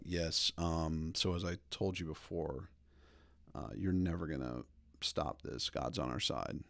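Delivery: lightly voiced stoic leader, low and soft; principled Chad. Imagined Chad figure addressing opponents, quietly resolute. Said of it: "like, a super principled Chad".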